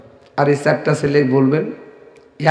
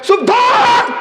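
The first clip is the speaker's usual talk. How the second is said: angry shout; much slower than usual. Retold or imitated. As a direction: bark it at the wife, very high. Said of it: "রাগে রাগান্বিত হয়ে বলতেছেন".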